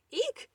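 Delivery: very high eek of surprise; a mouse squeals as cloth appears; startled squeak. Acted. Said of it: "she squeaked".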